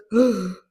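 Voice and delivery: sharp loud gasp of shock; sudden loud dramatic gasp. Retold or imitated. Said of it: "And Cassie goes".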